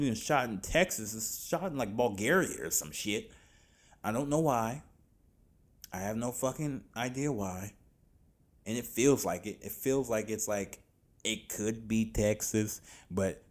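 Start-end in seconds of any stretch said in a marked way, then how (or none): none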